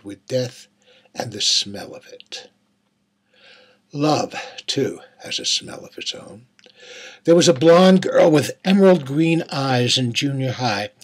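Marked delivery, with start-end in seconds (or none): 5.2-6.5 breathy hushed whisper. A narrator's reading. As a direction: let the words fall to a secret whisper. Narrator's hushed aside on love.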